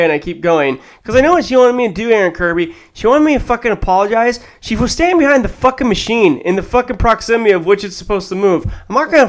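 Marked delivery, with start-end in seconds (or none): none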